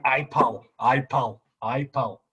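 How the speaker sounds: rough attempt at a Scottish accent. Shown as a demonstration. Asked for his best impression, an amateur Scottish accent. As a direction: try out a Scottish catchphrase, repeated. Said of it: "do your best impression of the Scottish accent".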